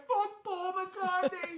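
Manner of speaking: very high, drawn-out British mock yell; British rival yelling. British guy yelling back while walking away, mock British holler. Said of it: "He's like".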